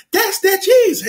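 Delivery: high, light voice for the guy; a lightly voiced shop owner; imitating the restaurant guy. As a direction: pitch up to play the restaurant guy. Voicing the Chinese restaurant guy.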